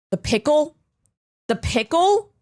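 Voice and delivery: high, amazed double take at "pickle"; excited about the pickle on his burger, disbelieving; surprised. Real, not acted.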